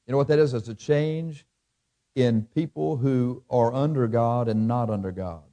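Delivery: ordinary talk, nothing marked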